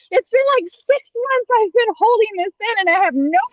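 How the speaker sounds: very high, strained retold protest; mock exasperated outburst. Explaining six months of holding it in, mock exasperated outburst. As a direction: let bottled-up frustration squeal out, half joking. Retelling own outburst after bottling up.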